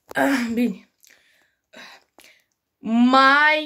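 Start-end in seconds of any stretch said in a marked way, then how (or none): none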